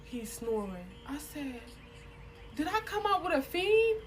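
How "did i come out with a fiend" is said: high-pitched appalled question; incredulous. Retold or imitated. Woman seeing her date snoring at table, incredulous.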